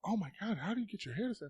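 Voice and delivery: high-pitched imitation of a teacher; gushing teacher. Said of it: "My teacher used to come over".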